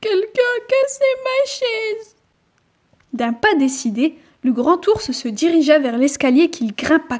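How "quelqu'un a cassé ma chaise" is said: much higher whimpering little bear; whiny baby bear near tears; pleurnicher. A narrator's reading. (petit ours qui pleurniche d'une voix aiguë)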